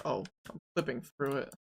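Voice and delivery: usual speaking voice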